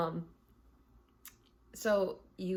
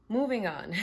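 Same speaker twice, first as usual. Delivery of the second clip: louder, higher, bright push; emphatic moving on; 4 semitones higher, 6 dB louder than usual. Real, not acted. Host jumps to the next topic, louder and higher. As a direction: announce the switch with a punch. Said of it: "All right, so".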